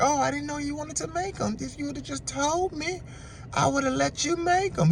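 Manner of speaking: high, soft imitation of her; mock a soothing, high-pitched partner; placating girlfriend. Woman placating boyfriend over the tacos, high and soft.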